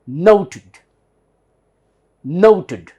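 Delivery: NOTED with a loud long "oh", ending in a weak "ud"; American "noted": loud NOH-tud; American pronunciation. Shown as a demonstration. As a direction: punch the "oh" in "noted", relax the ending to schwa plus d. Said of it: "let's see American pronunciation".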